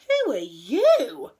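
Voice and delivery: loud, slow astonishment; gasped question. A narrator's reading. Friends face the creature on the doorstep, gasping. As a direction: draw it out in amazement. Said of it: "everyone gasped".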